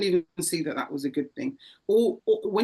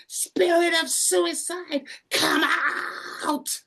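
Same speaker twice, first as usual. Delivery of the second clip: much higher, slow, commanding deliverance cry; preacher casting out; 11 semitones higher, 4 dB louder, much slower than usual. Retold or imitated. Preacher casting out the spirit, a slow higher commanding cry. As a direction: command the spirit out, stretched and loud. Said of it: "and he went".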